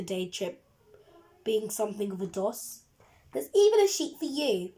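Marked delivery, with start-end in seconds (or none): 3.3-4.7 lightly voiced teacher, higher and louder; a touch of bossy schoolmistress; strict teacher voice. A narrator's reading. Teacher handing a sheet to the coach driver, mildly bossy.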